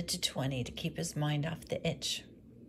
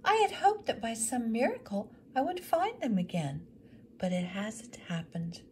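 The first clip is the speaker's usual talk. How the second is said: higher, then dropping to a whisper; hopeful widow; 6 semitones higher, 3 dB louder than usual. Acted. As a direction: let the hope fade at the end. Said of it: "she explained".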